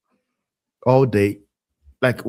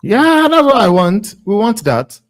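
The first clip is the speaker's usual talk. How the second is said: excited cheer; 9 semitones higher, 7 dB louder than usual. Real, not acted. Cheering the opponents' tiredness.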